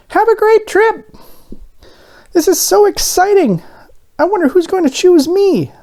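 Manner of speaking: very high, eager little donut voice. A narrator's reading. Arnie the donut on the tray, excited and high. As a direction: squeak it out with childlike excitement.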